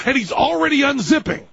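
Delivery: ordinary delivery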